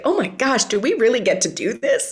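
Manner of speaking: high, giddy gush of wonder; bubbling-up excitement. Acted. Musician voicing the thrill of making songs, bubbling excitement. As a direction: let delight bubble up into a laugh at the end. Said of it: "get that bubbling up feeling".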